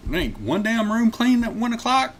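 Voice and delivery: high-pitched disbelieving protest; incredulous. Retold or imitated. Guest questioning the clerk about dirty rooms, incredulous. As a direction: voice climbs way up in disbelief.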